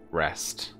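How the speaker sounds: normal talking voice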